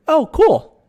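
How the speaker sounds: high, loud mock surprise; high, loud sarcastic "Oh, cool.". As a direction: fake amazement, loud and high. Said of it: "very sarcastic".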